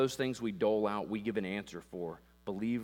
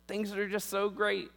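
fake cheer; 9 semitones higher, 5 dB louder than usual. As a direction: paint on a fake smile. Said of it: "this just feels fake".

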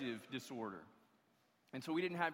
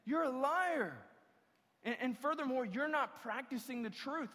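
a preacher voicing John's blunt accusation; John's rebuke; 8 semitones higher than usual. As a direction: call them a liar straight to their face. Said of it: "So he would look at you and say".

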